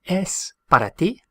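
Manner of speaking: teacher modelling a rising question. Shown as a demonstration. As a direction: ask it, voice climbing on "ti". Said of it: "So how would we say".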